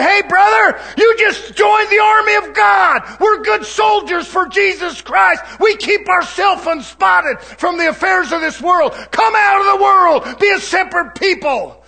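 rally the new recruit like a drill call; preacher welcoming a new believer into God's army; rousing preacher. Retold or imitated. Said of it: "The preacher needs to be teaching, say".

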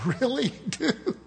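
high, drawn-out incredulity through laughter; mock disbelief. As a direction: laughingly ham up a stunned reaction. Joking imagined reaction to preacher.

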